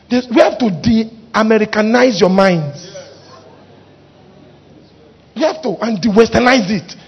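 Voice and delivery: ordinary delivery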